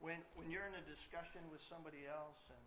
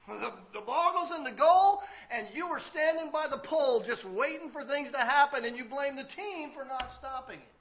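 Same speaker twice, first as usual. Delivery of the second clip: blaming voice; 10 semitones higher, 20 dB louder, slower than usual. Retold or imitated. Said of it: "This is what we do".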